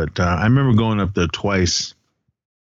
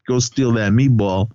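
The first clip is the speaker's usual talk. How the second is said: plain reading voice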